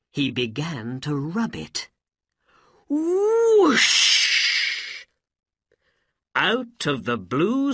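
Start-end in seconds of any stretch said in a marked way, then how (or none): 2.9-5.0 loud, very high, long whoosh; magic whoosh. A narrator's reading. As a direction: let out a drawn-out magical whoosh. Magic ring rubbed, sound imitated.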